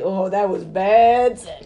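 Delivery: amused fake grumble; laughing mock complaint. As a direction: drag it out in a giggle. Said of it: "I don't think you could say".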